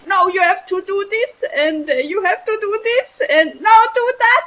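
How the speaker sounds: high, rising, bird-like chatter; frantic nagging head. Retold or imitated. The head nagging the speaker, shrill high frantic chatter. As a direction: pile on the orders until it squawks. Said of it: "because the head is saying".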